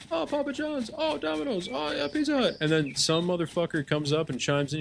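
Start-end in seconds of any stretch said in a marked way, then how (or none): 0.1-2.5 much higher mocking falsetto suggestions; high sing-song mock coworker. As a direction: ham up a coworker tossing out chains. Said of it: "And then somebody's like".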